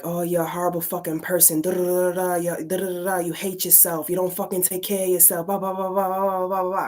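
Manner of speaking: rapid-fire nagging, barely pausing; mock berating that blurs into babble; voices in the head. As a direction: imitate an attacking inner voice running on relentlessly. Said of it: "then the voice is like".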